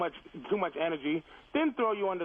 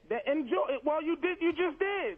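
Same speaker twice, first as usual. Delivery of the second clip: raised pitch; 7 semitones higher than usual. Real, not acted. Heated radio argument.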